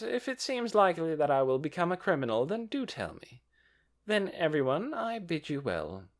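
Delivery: high, sweet and airy; sweet lady voice. A narrator's reading. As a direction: bid farewell with a smile. Solution leaves smiling.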